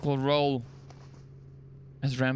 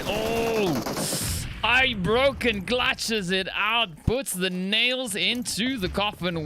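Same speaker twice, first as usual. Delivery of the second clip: higher, slow hype call; excited; 6 semitones higher, slower than usual. Real, not acted. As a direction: savour the clutch like a big finish. Caster calls the clutch win.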